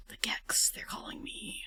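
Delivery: soft spooky whisper; whispered creepy summons; eerie whisper. Pretend. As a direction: whisper like a haunting voice.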